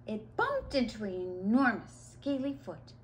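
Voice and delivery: ordinary delivery, nothing marked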